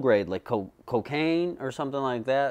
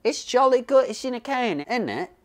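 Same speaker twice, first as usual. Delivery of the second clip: hammed-up British accent, pitched higher; mock British accent; 9 semitones higher, 3 dB louder than usual. Mocking British people who eat canned macaroni, in a jokey British accent. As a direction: play a jolly British toff with a British accent. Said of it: "They're like, British people".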